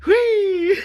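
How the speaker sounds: high, loud playful whee; gleeful whee. Pretend. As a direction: squeal it like a kid on a slide. Playful exclamation.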